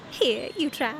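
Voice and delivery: normal talking voice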